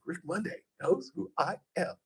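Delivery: softly amazed, as if hardly believing it; awed whisper to himself. Retold or imitated. Host recalling being recognized by his idol, hushed awe. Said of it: "And I was just like".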